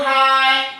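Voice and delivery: "Hai" called high and loud; called cue. Shown as a demonstration. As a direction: call "Hai" out to group one. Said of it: "Kelompok satu". (panggilan "Hai" yang tinggi dan keras)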